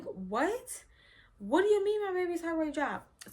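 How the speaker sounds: put-on panicked question about the baby; mother hears baby's heart rate dropped, alarmed and disbelieving; shocked disbelief. Retold shock at news.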